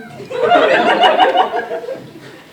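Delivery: loud, high cackle at the punchline; high burst of laughter. Real, not acted. Storyteller bursting out laughing at the whiskey reveal. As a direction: crack up at the reveal.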